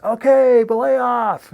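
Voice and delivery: shouted from far above, high and loud; distant shouted call. Retold or imitated. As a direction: yell it down the cliff from far away. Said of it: "and I hear this".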